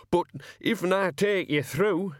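slow, hesitant working man; a worried coachman feeling his way; nervous coachman. Acted. Coachman asking his captor, slowly and uneasily.

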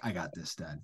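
low, hushed mock-cool kid; self-assured child. Son whipping out his iPhone to pay, coolly self-assured. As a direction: take over from dad, understated. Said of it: "whips out his iPhone and is like".

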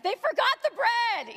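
high, drawn-out wail of mock horror; dramatic reading. Shown as a demonstration. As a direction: read it as a huge tragedy, pitch soaring. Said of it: "So I would read it like".

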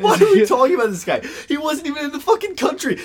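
heated griping; exasperated rant. Real, not acted. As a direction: pile on the outrage. Cohost piling on in exasperation.

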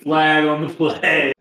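high, slow mock referee call. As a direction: announce the foul like a ref. Calling a mock penalty.

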